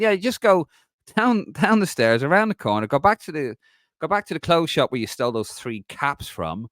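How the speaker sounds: hammed-up Dublin accent, starting high and easing down; thickest Dublin accent. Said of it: "in the thickest Dublin accent".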